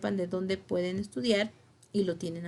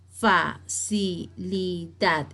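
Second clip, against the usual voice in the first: "facilidad" very slowly as fa, ci, li, dad; evenly spaced syllables in "facilidad"; split into syllables; 2 semitones lower, much slower than usual. Shown as a demonstration. Teacher showing the division, "facilidad" evenly syllable by syllable. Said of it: "Está dividida en sílabas".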